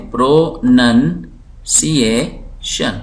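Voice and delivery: پروننسیشن said slowly as pro-nun-si-ay-shun; syllable-by-syllable reading. Shown as a demonstration. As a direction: break پروننسیشن into slow, separate syllables. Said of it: "اس کو ہم پڑھتے ہیں". (پروننسیشن کو آہستہ، ٹکڑوں میں پڑھنا)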